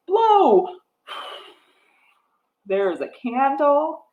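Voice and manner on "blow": blowing out candles. Pretend.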